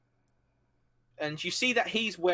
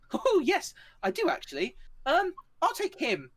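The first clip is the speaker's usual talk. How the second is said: a much higher, eager voice with a catch in it; eager high NPC voice. Acted. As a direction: chirp it out, thrilled with himself. GM voices President Shah answering.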